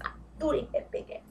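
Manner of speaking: a gossipy unvoiced whisper; whispered aside. Real, not acted.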